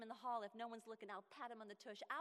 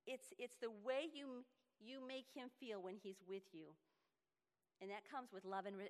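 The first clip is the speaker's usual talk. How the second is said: ordinary delivery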